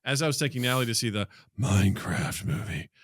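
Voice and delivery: normal talking voice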